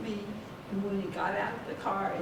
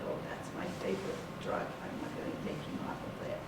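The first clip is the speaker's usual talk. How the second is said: hushed whisper of the doctor's words; breathy hushed retelling; whispered quote; 6 dB quieter, faster than usual. Retold or imitated. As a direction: whisper it as if telling a secret. Quoting the doctor's refusal.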